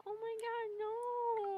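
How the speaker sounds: high, plaintive retold whine; mock wail. Co-host retells watching the sad arc, mock-heartbroken. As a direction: whimper it like a heartbroken viewer.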